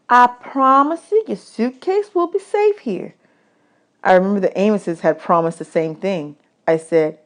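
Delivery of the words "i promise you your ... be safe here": higher, mock-grand woman's voice; woman at camp. Camp woman teasing boys about their tin china, higher. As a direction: serve it like fine china, teasing.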